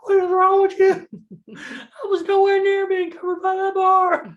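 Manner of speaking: mock furious shriek at a friend; imitated yelling. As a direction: yell it like a furious little guy. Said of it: "He started yelling".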